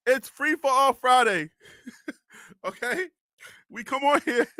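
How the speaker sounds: real chuckle through the words; amused, breaking into laughter; laughing. Real, not acted. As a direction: say it while cracking up. Laughing while defending off-topic chat.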